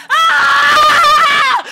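long high full-force scream; roaring scream. A narrator's reading. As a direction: scream at him with everything you have. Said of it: "brülle ich ihn an". (langer, lauter Schrei)

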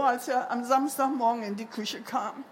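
usual speaking voice

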